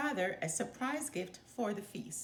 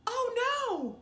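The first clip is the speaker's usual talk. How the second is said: high, sudden cry of dismay; as if she just found the gift gone; dismayed gasp; 13 semitones higher, 4 dB louder than usual. A narrator's reading. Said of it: "She gasped when she realized".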